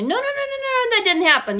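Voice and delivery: high-pitched, rapid string of denials; brain in denial. Acted. Brain telling you it didn't happen, high frantic denial. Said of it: "your brain is telling you".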